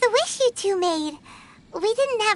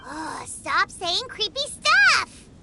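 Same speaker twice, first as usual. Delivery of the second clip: exasperated outcry; slower than usual. Acted. Companion creeped out by the director, exasperated outcry. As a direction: protest slowly, bursting out on the final word.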